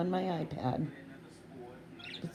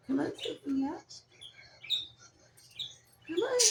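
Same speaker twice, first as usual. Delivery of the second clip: high coaxing voice; 9 semitones higher than usual. Real, not acted.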